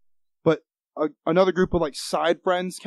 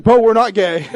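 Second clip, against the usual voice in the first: mock retort; 5 semitones higher, 8 dB louder than usual. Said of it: "I was like".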